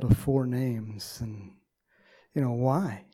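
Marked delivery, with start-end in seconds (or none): none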